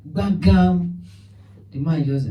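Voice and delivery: ordinary talk, nothing marked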